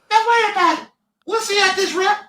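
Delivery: very high squeaky warning; high-pitched voice. Retold or imitated. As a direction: squeak it like the lineman. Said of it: "the most high-pitched voice that you've ever heard".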